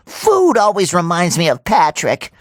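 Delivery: ordinary delivery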